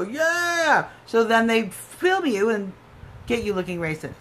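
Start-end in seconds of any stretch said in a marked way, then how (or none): 0.0-0.9 mock troll cheer. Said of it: "trolls ... respond to them and go".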